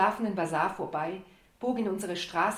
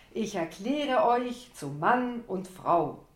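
ich erkläre euch zu Mann und Frau stretched slow, louder and higher; drawn out like a compère; 4 semitones higher, 6 dB louder, much slower than usual. Acted. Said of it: "zog meine Worte in die Länge wie der Conférencier".

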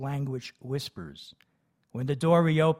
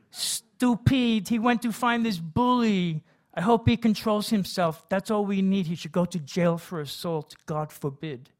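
worried mother; 6 semitones higher, 3 dB louder than usual. A narrator's reading.

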